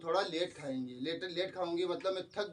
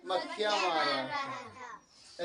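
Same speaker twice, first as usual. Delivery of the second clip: drawn out slowly through laughter; laughing; 4 semitones higher, much slower than usual. Retold or imitated. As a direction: laugh the words out. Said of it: "मैं क्या कर रहा था सुबह से?".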